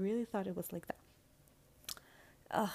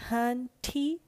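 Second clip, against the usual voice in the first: loud, punched "hunty" with attitude; sassy loud drag-style address; 5 semitones higher, 9 dB louder than usual. Acted. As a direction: throw "hunty" at them with sass.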